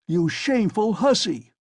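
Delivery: outraged officer yelling; shouting. Acted. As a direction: bark it at the top of your voice. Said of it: "he shouted suddenly at the top of his voice".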